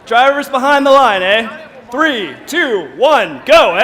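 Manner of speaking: announcer countdown. Pretend. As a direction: call the start loudly, ending on a playful Canadian tag. Match countdown in mock-Canadian announcing.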